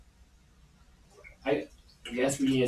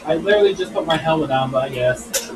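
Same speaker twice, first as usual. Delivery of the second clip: yelling back; 3 semitones higher, 9 dB louder than usual. Real, not acted. Answering the dinner call loudly.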